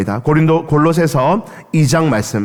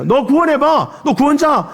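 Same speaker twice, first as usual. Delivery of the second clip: much higher, sing-song jeer; teasing taunt; 7 semitones higher than usual. Retold or imitated. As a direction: dare him, laughing at him. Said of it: "사람들이 놀렸어요".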